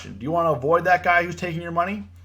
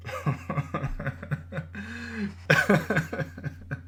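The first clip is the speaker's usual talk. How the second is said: long, helpless laugh, pitched up; 5 semitones higher, 4 dB quieter than usual. Real, not acted. Trader cracking up after getting heated, long laugh. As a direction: let a real laugh run on.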